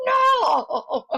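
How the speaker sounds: long, high, loud cry of defeat; mock-agonized wail. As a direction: wail in playful despair. Retold losing the card game.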